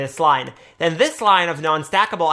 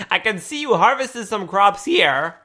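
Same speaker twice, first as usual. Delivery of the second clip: ordinary delivery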